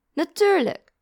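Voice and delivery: reassuring answer called from afar; calling over noise. A narrator's reading. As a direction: call out over the roaring boiler. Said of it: "riep hij over het geluid van de boiler heen".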